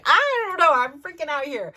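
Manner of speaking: loud, high flustered outburst; retold freak-out. Mom to her son at boarding, freaking out. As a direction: burst out high, then ease down.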